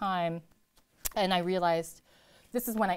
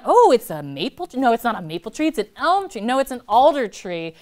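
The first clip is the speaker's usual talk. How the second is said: loud, higher, quick self-corrections; excited guessing; 5 semitones higher, 11 dB louder than usual. Retold or imitated. Poet acting out her own tree guessing, excitedly. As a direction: fire off guesses at the trees, louder each time. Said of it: "and then I'm like".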